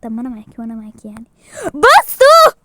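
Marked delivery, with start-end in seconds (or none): 1.7-2.6 high, very loud shout; sharp, high attention call; demanding attention. Acted. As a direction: yell for everyone to look.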